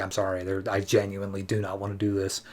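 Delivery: sorry refusal. Retold or imitated. Aquaman apologizing to his brother Orm, soft and regretful. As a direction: let him down gently, hushed and sincere.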